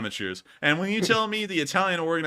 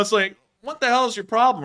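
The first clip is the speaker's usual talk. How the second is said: ordinary delivery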